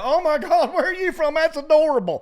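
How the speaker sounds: mock valley girl squeal at a Southern accent; gushing Californian. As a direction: squeal it like an amazed Angeleno waitress. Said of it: "They go".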